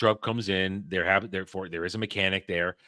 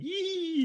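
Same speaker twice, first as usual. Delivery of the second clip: very high, drawn-out "eee"; high gleeful squeal. Retold or imitated. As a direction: imitate a giggling getaway squeal. Imitates friend running away.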